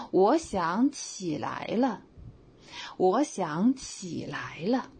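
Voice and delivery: 起来了 slow and stressed as 'get up'; slow, lean on 起来了 meaning to get up. Shown as a demonstration. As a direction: slowly press on 起来了. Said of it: "你看重点在 "起来了".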